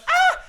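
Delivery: shrill, very high loud scream of panic; goons screaming. Retold or imitated. Fleeing goons scream at Batman, high and loud. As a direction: shriek like terrified thugs. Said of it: "And they're like".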